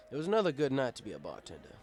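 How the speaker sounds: plain reading voice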